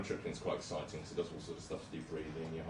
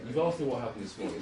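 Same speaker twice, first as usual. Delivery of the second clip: ordinary talk, nothing marked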